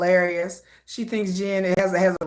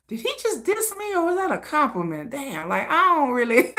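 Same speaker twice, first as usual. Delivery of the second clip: puzzled musing; 4 semitones higher, 3 dB quieter than usual. Retold or imitated. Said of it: "you be trying to figure it out".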